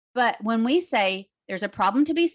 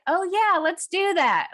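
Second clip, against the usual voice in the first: mock cheer; 8 semitones higher than usual. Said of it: "Like, kinda like this".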